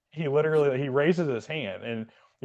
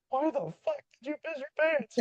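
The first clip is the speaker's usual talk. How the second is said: high squeaky mock instructor voice; shrill up the pitch like a cartoon instructor; mock drill instructor; 4 dB quieter than usual. Instructor grilling the trainee who wet himself, high mock voice.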